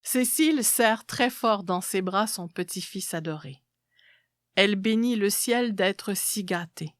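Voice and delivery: usual speaking voice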